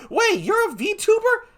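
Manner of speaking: very high, amazed exclamation; high-pitched, delighted disbelief; excited surprise. Real, not acted. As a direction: squeal in astonishment at a viewer's news. Surprised reaction to a viewer's news.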